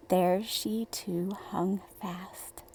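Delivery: slow whisper; hushed narration. A narrator's reading. Narrator hushing the listeners as the sister sticks, slow whisper. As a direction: whisper it slowly like a secret.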